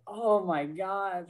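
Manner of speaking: high, slow awed "oh my God"; overwhelmed gasp. Retold or imitated. Reader recalling her reaction to the scene, high slow awe. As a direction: let it catch in wonder.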